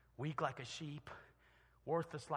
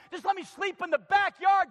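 raised, begging plea to Dad; pleading son; 15 dB louder than usual. Acted. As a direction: beg like a ruined son come home. Son begs to sleep outside.

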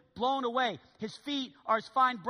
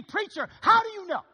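mock congregant; 10 semitones higher, 9 dB louder than usual. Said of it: "And you say to me".

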